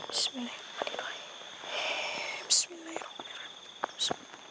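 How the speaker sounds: a barely audible murmured invocation; whispered prayer. Real, not acted. Ghost hunter whispers a prayer to lock the spirit. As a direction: breathe the prayer with no voice at all, slowing on the second one.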